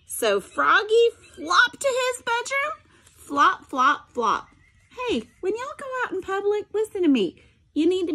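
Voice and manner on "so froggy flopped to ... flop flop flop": lively read-aloud voice with sound effects; story narration. A narrator's reading. Teacher reading a picture book to her students, animated storytelling.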